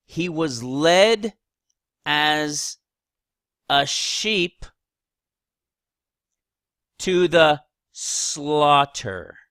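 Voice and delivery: very slow, weighted word by word; deliberate scripture reading. Acted. Preacher reading a verse to his listeners, slow and emphatic. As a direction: draw out each phrase with long pauses.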